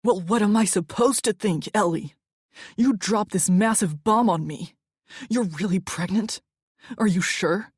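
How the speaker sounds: loud, exasperated demand; he doesn't care who hears. Acted. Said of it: "didn't seem to care about keeping his voice down".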